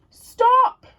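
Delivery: shout. Acted. Giant wife stopping the bean mess, loud shout. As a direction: cut them off with a yell. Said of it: "cried Missus Giant".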